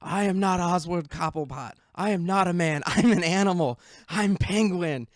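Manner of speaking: high, loud dramatic proclamation; Penguin. Retold or imitated. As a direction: declaim it loudly like a defiant villain. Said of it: "Penguin says at one point".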